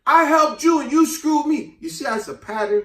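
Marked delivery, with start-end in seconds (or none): 0.1-1.8 high, loud, slow anguished cry; blinded wife crying out; betrayed cry. Retold or imitated. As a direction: wail it out, pitched well up.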